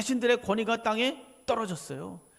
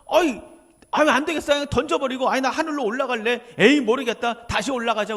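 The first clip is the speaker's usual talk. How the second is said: loud, higher, throwing it all away; hammed-up exasperated outburst with punched interjections; fed-up quitting; 4 semitones higher, 7 dB louder than usual. Preacher playing Jesus quitting to go back to heaven, fed up.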